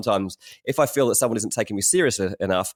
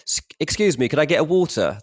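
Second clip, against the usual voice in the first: put-on polite, higher-pitched English accent; plain English accent; 6 semitones higher than usual. Englishman asking for water in America, polite English accent. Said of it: "if you say the other thing".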